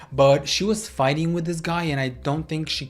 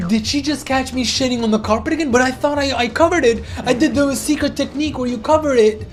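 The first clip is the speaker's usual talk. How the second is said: worried dog voice; 8 semitones higher, 4 dB louder than usual. Retold or imitated. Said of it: "The dog was so concerned, like".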